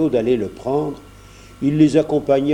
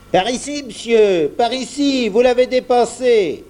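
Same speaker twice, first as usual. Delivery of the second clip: much higher, calling out to stop him; calling after him; 9 semitones higher than usual. A narrator's reading. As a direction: shop boy hailing a man who walked past. Jeannot calls after the Englishman. (Jeannot appelle, voix haute)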